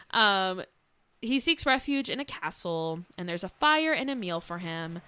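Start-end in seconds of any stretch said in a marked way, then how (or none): none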